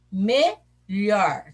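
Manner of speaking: "Melhor" split into me and lhor, both parts distinct; syllable by syllable. Shown as a demonstration. Teacher helps pupils count syllables, "Melhor" said in two separate parts.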